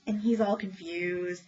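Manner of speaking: usual speaking voice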